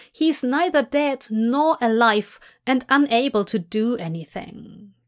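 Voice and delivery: ordinary delivery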